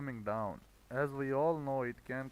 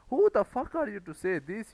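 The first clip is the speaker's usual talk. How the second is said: loud and higher, outraged scolding; angry rant; 6 semitones higher, 8 dB louder than usual. Real, not acted. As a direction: tear into the impatient trader. Ranting at impatient traders.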